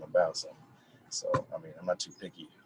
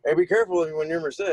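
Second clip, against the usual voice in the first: high, louder mock warning; cousin's warning; 9 semitones higher, 7 dB louder than usual. Cousin phoning to warn him, mock-urgent. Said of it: "He was like".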